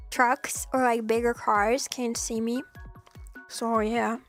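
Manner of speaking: usual speaking voice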